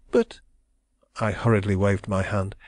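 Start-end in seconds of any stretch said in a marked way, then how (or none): 0.1-0.4 put-on distressed young woman. A narrator's reading. Young woman protesting her love, high and anguished. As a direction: cry it out as the wronged heroine. Heroine's voice.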